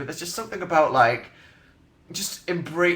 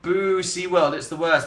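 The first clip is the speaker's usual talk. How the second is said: lightly voiced jeer, pitched up; mock booing crowd; 5 semitones higher than usual. Everyone reacting to SeaWorld, mock booing. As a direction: play a booing public, lightly. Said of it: "everyone's like".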